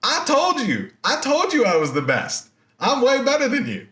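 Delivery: high, boastful taunt; bragging Arachne. Retold or imitated. Arachne gloating at Athena, high and smug. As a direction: crow over her rival in a lifted voice. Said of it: "So Arachne is now like".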